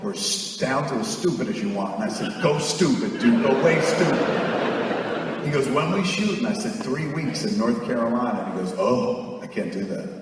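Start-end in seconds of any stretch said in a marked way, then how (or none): none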